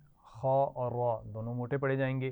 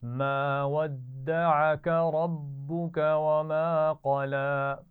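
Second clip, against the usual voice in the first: teacher chanting Quran for students to echo; Quranic recitation; 3 semitones higher, 5 dB louder, much slower than usual. Shown as a demonstration. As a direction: model the verse slowly for the class to repeat. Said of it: "एक दफ़ा फिर पढ़ते हैं".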